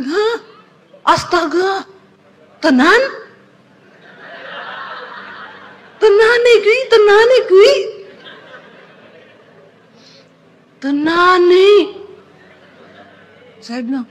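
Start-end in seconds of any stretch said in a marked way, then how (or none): 0.0-11.7 can't believe your eyes; stunned disbelief. Retold or imitated. Wife seeing the pastor's number on the screen, high stunned disbelief.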